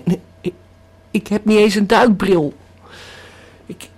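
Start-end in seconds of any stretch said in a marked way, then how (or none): none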